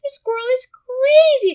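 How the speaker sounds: very high, loud squealing dog; a scared dog shrieking as it flees; panicked dog. Acted. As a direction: yelp it out in alarm. Frightened dog in story.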